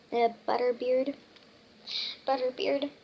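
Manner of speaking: plain reading voice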